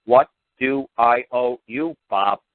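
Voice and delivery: slow, deliberate, one word at a time; slow, blunt model line for the letter; plain simple demo. Shown as a demonstration. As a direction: spell it out slowly, man to man. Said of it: "Keep it to one syllable words".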